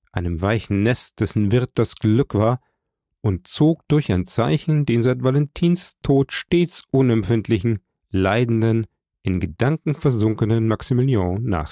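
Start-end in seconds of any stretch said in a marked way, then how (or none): none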